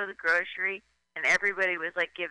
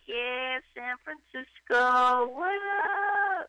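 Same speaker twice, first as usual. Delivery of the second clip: hyped stand-up host; 5 semitones higher, much slower than usual. Retold or imitated. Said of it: "I'd be like".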